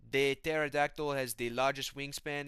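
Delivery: slow, put-on French-Canadian accent; GSP. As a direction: imitate a fighter lecturing in a French-Canadian accent. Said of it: "G S P keeps telling him information".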